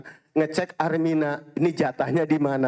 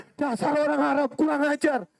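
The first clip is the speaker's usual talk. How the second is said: mock angry outburst; 9 semitones higher than usual. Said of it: "Saking keselnya marah".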